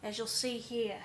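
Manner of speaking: brisk Australian wildlife-documentary narration; Australian crocodile hunter. Acted. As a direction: narrate like an Australian outback guide stalking rare wildlife. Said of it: "crocodile hunter or like an Australian outback".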